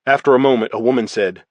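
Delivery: ordinary delivery, nothing marked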